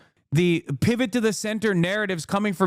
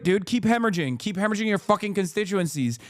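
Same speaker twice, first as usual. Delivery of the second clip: ordinary talk, nothing marked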